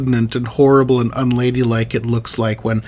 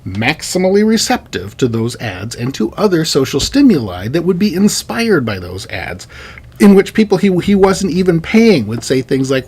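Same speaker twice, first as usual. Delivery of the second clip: usual speaking voice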